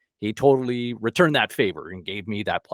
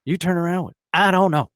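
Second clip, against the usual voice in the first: lightly voiced [name], mock indignant; [name] ribbing; 8 semitones higher, 4 dB louder than usual. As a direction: rib him for having no answer.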